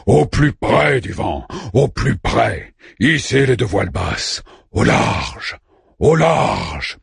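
slow, stretched-out stage commands; theatrical recitation. A narrator's reading. Orpheus resumes the play after the cue, slow declamation. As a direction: draw out each order like an actor declaiming.

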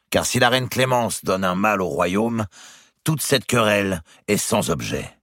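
steady the quarrel with reason; Poitiers explaining firmly. A narrator's reading. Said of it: "dit le comte de Poitiers".

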